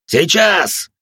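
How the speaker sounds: loud, high bark; barked command. Acted. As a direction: snap out the signal. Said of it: "рявкнул отец Марк".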